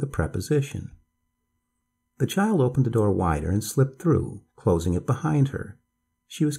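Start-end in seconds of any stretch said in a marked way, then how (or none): none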